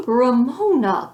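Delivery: slow, loud shocked calling of a name; horrified call. A narrator's reading. Beezus catching her sister licking a lollipop, horrified call. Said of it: "Beezus was horrified".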